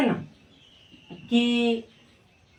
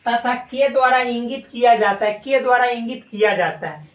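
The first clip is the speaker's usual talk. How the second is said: ordinary talk, nothing marked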